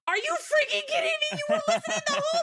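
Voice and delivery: high, loud, incredulous outburst; hammed-up indignant yell; mock outraged shout. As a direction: explode in disbelief, laughter creeping in. Retold outrage at being overheard.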